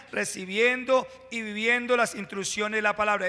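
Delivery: usual speaking voice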